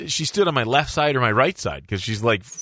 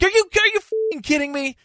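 high-pitched retold disbelief; incredulous outburst. Patient replying to the doctor's prank, incredulous. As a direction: shoot the pitch way up, aghast.

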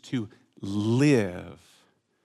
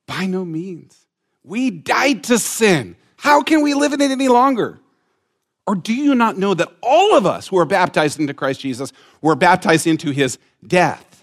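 loud, much higher, preaching at full force; fervent scripture reading; 6 semitones higher, 8 dB louder than usual. Acted. Preacher reads Romans six to the church, fervent. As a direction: thunder the verse out.

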